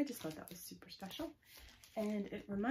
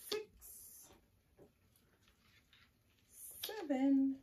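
suspenseful counting with long pauses; story recital; 4 dB louder, much slower than usual. Acted. Telling children the ten-on-a-sled story, suspenseful counting. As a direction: count up slowly, building to a louder finish.

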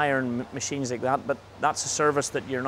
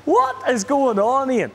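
excited; 10 semitones higher, 7 dB louder, slower than usual. Pretend. Hammy greeting after laugh.

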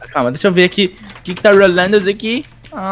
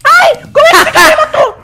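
crying shout; 7 dB louder than usual. Real, not acted. Dying in game, crying out.

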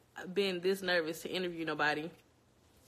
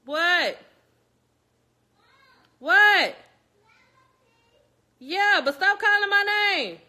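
yelling offscreen; 11 semitones higher, 14 dB louder, slower than usual. Real, not acted. Mother calling out to her kids during interview, loud.

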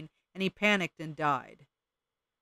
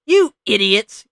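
slow, much louder furious bird yell; 5 semitones higher, 13 dB louder, slower than usual. Acted. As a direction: explode at them like an unhinged little bird. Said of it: "yelled the Junco".

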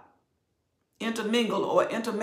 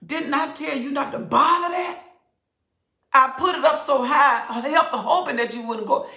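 scolding parent; 7 semitones higher, 7 dB louder than usual. Retold or imitated. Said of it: "it's just like a kid".

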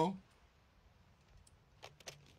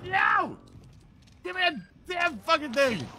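raging gamer; 6 semitones higher than usual. Real, not acted. Lag frustration in match.

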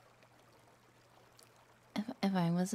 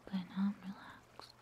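hushed, airy talk; soft whispery voice; 3 semitones lower, 6 dB quieter than usual. Real, not acted. Streamer whispering softly to chat. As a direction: whisper it close to the mic. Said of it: "I sound weird when I talk like this".

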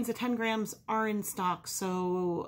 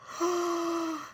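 delighted gasp; 9 semitones higher than usual. Real, not acted. Seeing the new cards.

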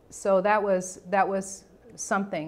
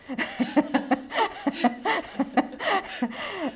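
incredulous laugh; 12 semitones higher than usual. Real, not acted. Reacting to absurd diagnosis.

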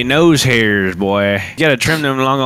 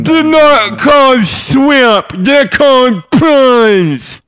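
high, loud, slow Australian yell; Aussie accent shout; 12 semitones higher, 5 dB louder, much slower than usual. Acted. Friend shouts at the lobby in a hammed-up Australian accent. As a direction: bellow it in an overdone Australian accent. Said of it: "Chuck on your Aussie accents".

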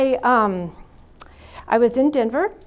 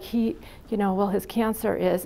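usual speaking voice

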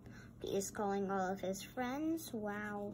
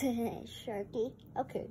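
usual speaking voice

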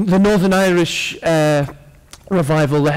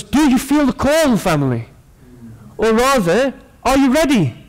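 rousing call; 7 semitones higher than usual. Real, not acted.

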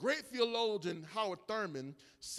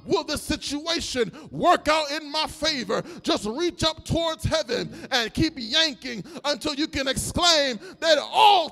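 louder, rhythmic preaching cries over the music; full-force preaching; 5 semitones higher, 10 dB louder than usual. Acted. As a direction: lift the church, call it out.

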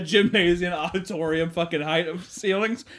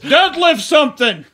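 slow, high, loud taunt; mocking ad voice; 11 semitones higher, 10 dB louder, slower than usual. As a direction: jeer it like an attack ad. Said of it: "mocking ad quote".

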